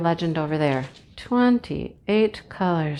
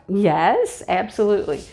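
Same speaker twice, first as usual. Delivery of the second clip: normal talking voice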